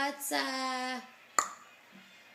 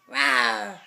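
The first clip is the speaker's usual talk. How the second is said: mock roar for the toddler; playful lion roar; 3 semitones lower, 6 dB louder than usual. Shown as a demonstration. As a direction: growly pretend lion. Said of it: "what's the lion say?".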